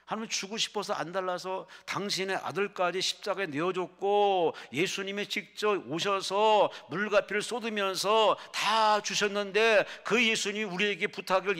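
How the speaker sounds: loud, high, driving exhortation; full-force preaching. Acted. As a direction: cry it out to the whole congregation. (목청껏 부르짖는 설교)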